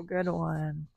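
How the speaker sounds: ordinary delivery, nothing marked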